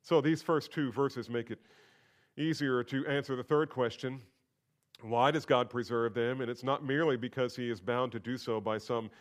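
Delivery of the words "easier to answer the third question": ordinary talk, nothing marked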